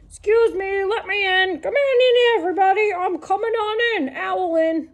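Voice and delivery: high, chirpy owl asking to come in. Acted. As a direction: squeeze in as a pushy little owl. Owl voiced in read-aloud story.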